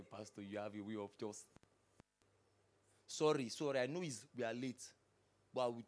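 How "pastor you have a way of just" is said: much lower, much softer grumble; a hushed imitation of a sulky member; mumbled mock complaint. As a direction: mutter it like a sulking member. Congregant's mumbled complaint voiced.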